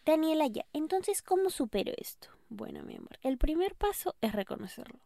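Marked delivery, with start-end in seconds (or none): 0.1-2.3 higher pleading listener voice; voicing a listener. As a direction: ask her for help, high and soft. Listener voiced asking Daniela.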